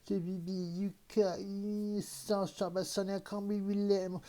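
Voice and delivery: imitating online critics with a high mock whine; mocking the haters. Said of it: "they've turned around".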